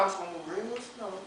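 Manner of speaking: fast, soft mock whisper-plan; lightly voiced naive sinker; mock cautious plan. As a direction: say it sly and low. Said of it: "talking about".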